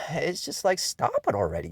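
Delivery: ordinary delivery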